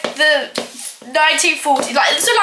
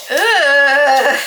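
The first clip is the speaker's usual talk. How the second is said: loud, long groan of disgust; 6 dB louder than usual. Real, not acted. Baker seeing lumps of butter, disgusted groan. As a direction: groan "ugh" loudly and drag it out.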